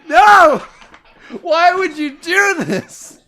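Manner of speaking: shrieking high despair at the failed roll; anguished scream. Real, not acted. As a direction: howl in real disbelief. Player fails a roll.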